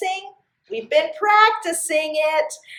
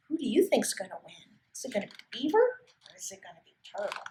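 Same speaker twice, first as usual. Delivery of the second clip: hushed aside; 4 semitones lower, 12 dB quieter, much faster than usual. Real, not acted. Asks young listeners aside.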